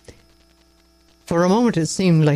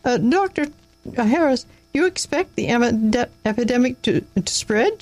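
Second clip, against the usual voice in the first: high, hesitant, stumbling woman's voice; nervous woman; 8 semitones higher than usual. A narrator's reading. A nervous woman asking the doctor about the epidemic, high and faltering. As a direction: falter nervously through the question. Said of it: "gave a nervous little laugh".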